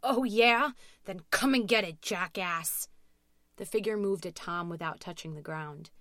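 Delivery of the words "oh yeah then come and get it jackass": loud, defiant taunt; arrogant shout. A narrator's reading. Tom scared but taunting the figure, arrogant shout. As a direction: shout it cockily, easing off at the end. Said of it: "had enough arrogance to shout".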